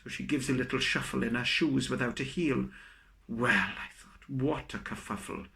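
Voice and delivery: normal talking voice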